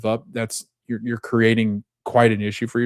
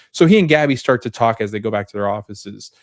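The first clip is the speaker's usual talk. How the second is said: ordinary talk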